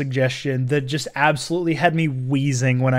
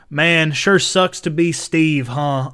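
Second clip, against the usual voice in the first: slow, lightly put-on southern accent; slower than usual. Actor redoes his own movie line in a southern accent. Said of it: "southern accent".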